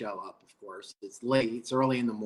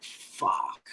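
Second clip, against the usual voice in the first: whispered curse; 3 dB quieter than usual. Retold or imitated. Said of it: "And I'm like".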